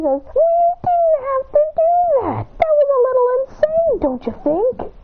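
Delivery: falsetto, loud, scolding; high squeaky voice. Retold or imitated. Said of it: "He says".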